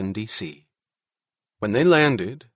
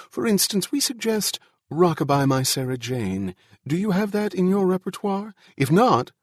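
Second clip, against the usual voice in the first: high-pitched, eager suggestion; genial, fussy host in a raised voice; effusive official; 9 semitones higher than usual. Acted. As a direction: bubble along politely, pitch lifted. Genial official keeps bubbling on.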